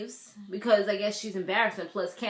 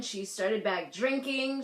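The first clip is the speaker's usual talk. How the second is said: usual speaking voice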